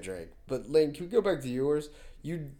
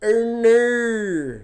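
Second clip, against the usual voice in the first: high, loud mock-Australian cry; exaggerated Australian wail; Australian accent; 6 semitones higher, 7 dB louder than usual. Shown as a demonstration. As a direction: overdo the Australian vowels in "no". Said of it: "That's my Australian accent".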